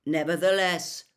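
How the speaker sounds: lightly voiced stern centaur; calm centaur. A narrator's reading. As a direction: speak with cold calm authority. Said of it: "said Megorian calmly".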